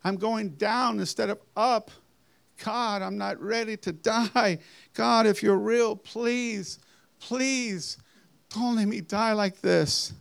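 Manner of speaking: rising high, desperate cries to God; frantic pleading. Retold or imitated. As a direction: beg for your life as you fall. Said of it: "And I started screaming".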